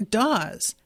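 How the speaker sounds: normal talking voice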